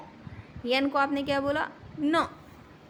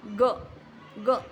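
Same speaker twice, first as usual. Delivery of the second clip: ordinary delivery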